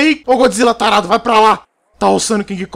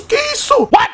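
much higher startled question; can't believe what he sees; shocked cry. Real, not acted. Player shocked by the chaos on screen, high startled cry.

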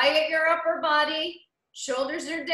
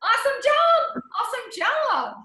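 much higher, louder praise that settles down; excited praise; 9 semitones higher, 4 dB louder than usual. Real, not acted. Spin instructor praising riders after the interval, excited. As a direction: lift your voice up high to praise them.

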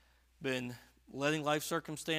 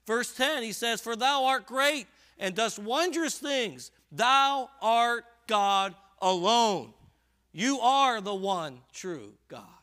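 loud, high, driving sermon voice; preaching at full force; 8 semitones higher, 11 dB louder, slower than usual. Real, not acted. Preacher urging his flock, in a loud high push. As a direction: press the congregation hard with rising force.

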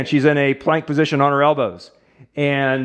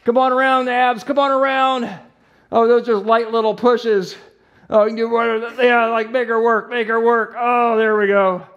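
higher, urgent calls of quick, quick with laughs; rapid-fire directions, half laughing; excited coaching; 7 semitones higher than usual. Real, not acted. Therapist directing Abby's pushes on Sammy, excited and laughing. Urging partner to push harder.

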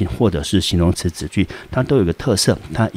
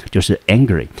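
ordinary talk, nothing marked

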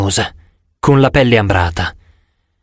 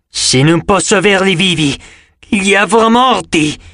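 higher-pitched monster threat, slowing at the end; snarling manticore; 5 semitones higher than usual. A narrator's reading. Manticore threatens to kill its captives, snarling. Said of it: "La manticora ringhiò".

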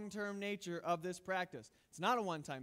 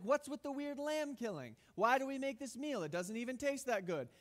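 high, puzzled young voice mocking the ritual; lightly voiced confused youngster; confused kids; 7 semitones higher than usual. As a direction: play the baffled newcomer, pitched up. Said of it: "They're like".

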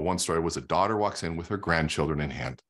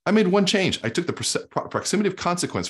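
emphatic burst; 11 semitones higher than usual. Real, not acted. Emphatic point in talk.

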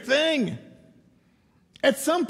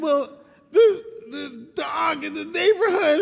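put-on crying kid; 7 semitones higher than usual. Boy sobbing to his dad about the dogs. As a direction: choke the words out through tears. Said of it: "here I was crying".

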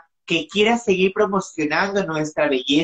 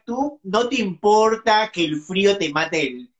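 ordinary talk, nothing marked

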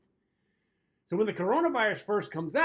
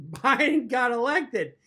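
laughing; 5 semitones higher, 5 dB louder, slower than usual. Real, not acted. Punchline laughed through.

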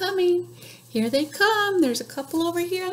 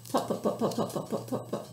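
whispered fading pop pop; tractor putter; 4 semitones lower, 9 dB quieter, faster than usual. Pretend. Librarian making the tractor noise, fading whisper. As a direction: putter softly away like a tractor.

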